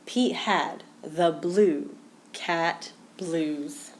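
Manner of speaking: ordinary delivery, nothing marked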